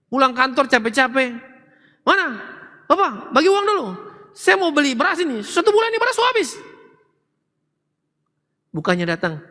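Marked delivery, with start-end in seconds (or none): 2.0-6.5 high, fast nagging wife demanding money. Retold or imitated. Preacher mocks wife nagging her tired husband for money, high and fast. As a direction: hammer him for rice money the moment he walks in.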